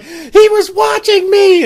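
very loud, high, drawn-out shriek; panicked shriek. Retold or imitated. Friend fleeing the man with the shotgun, panicked. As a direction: imitate a hysterical friend, slow and screaming.

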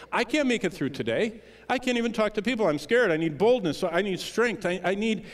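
a frightened believer begging for help; scared plea. Pretend. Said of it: "We say".

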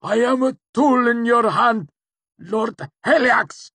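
very high, loud tortured plea; crumpling, desperate scream of obedience; agonized, submissive cry. A narrator's reading. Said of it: "Cinder amended as he crumpled".

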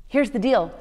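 ordinary talk, nothing marked

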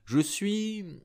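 a knowing stranger listing his trades; mysterious man musing. A narrator's reading. Siachi answers the narrator, amused and evasive.

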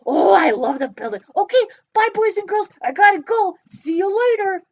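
a bouncy little puppet popping up. Pretend. Puppet Joe says goodbye.

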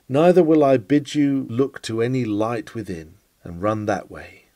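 normal talking voice

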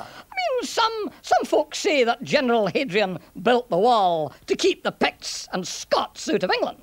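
lilting Scots storyteller; sing-song Scots burr; Scottish centurion, explaining. Acted. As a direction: weigh up both opinions in a Scottish accent.

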